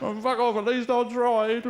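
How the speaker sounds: high, laughing comeback, slowing down; laughing retort. Acted. Rejected lad snapping back at jeering mates, laughing. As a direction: brush off the mates through laughter.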